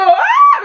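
very high loud yelping ah; panicked shriek. Retold or imitated. Teen girl startled when her crush speaks to her, very high shriek. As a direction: squeal in sudden panic. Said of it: "y yo".

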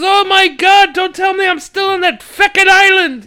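put-on high, loud, anguished old priest wail; cranky old priest. Father Jack realising he's still on the island, wailing. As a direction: howl it like a horrified drunk priest.

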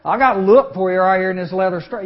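2 loud threatening dad voice; 3 boom it like a strict father reaching for the strap; 4 strict dad answering a backtalking son; 1 stern father's warning. Retold or imitated. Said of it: "Tyree Moser".

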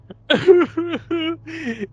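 high, exaggerated weeping noises; mock sobbing. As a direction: fake a widow's sobs. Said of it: "she's just like".

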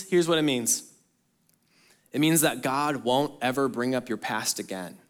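ordinary talk

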